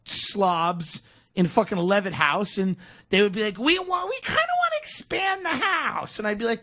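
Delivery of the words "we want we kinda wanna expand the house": high mock customer voice. Imitating customers.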